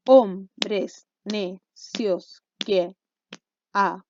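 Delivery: slow, low, clapped-out syllables with gaps between. Shown as a demonstration. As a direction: break the line into separate, evenly beaten syllables. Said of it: "si les facilita más aplaudir, háganlo conmigo".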